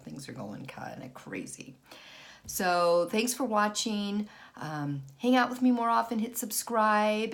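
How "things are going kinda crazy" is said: hushed confiding aside; whisper. Real, not acted. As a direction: whisper it to the camera.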